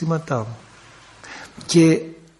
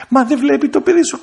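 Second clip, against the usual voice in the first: high anxious pleading; pleading mother; 9 semitones higher, 4 dB louder than usual. Retold or imitated. Mother begs priest to see her crying child, high pleading. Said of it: "κλαίει".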